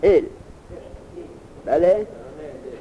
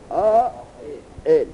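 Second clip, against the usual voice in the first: slow, stretched recitation of فعائل; drawn-out reading; much slower than usual. Shown as a demonstration. Teacher recites the pattern فعائل slowly for the student. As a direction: draw فعائل out slowly, syllable by syllable.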